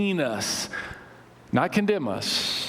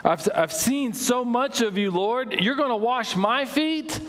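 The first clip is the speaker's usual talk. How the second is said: higher pitched, astonished questioning of the Lord; Peter in disbelief; 6 semitones higher than usual. Acted. Peter protesting to Jesus, high and incredulous. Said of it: "said to him".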